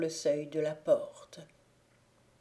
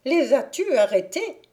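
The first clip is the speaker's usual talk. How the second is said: loud, much higher demand; enraged fairy shouting at the squire; angry cry; 8 semitones higher, 10 dB louder than usual. Acted. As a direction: cry out in fury. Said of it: "s'écrie la fée".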